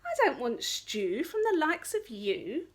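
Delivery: a vain princess sneers at the wolf; scoffing. Acted. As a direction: look down your nose as you scoff. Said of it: "scoffed the princess".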